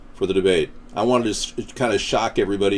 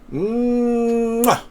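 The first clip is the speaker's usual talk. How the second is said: exaggerated kiss sound; 11 semitones higher than usual. Blows a kiss.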